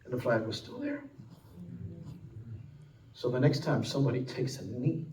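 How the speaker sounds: preacher lowering his voice for the sacrifice; hushed solemn sermon close. Acted. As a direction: let it sink in quietly.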